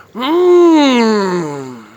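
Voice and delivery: hammed-up long loud yummy moan; loud mock moan of delight. Streamer tasting pizza for viewers, loud mock moan. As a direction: overdo the pleasure of the first bite. Exaggerated eating moans.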